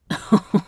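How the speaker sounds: short wicked cackle of a Southern mother; scheming cackle. A narrator's reading. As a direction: cackle it out, pleased with herself. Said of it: "Constance cackled".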